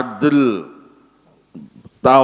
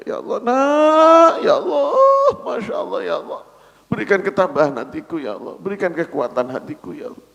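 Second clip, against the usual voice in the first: tearful prayer; 6 semitones higher than usual. Acted. Grieving believer prays to God.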